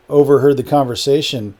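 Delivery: ordinary delivery, nothing marked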